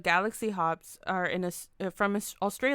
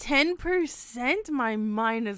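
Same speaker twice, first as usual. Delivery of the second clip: amazed; 3 semitones higher, 4 dB louder, slower than usual. Real, not acted. Amazed by strong smooth beer.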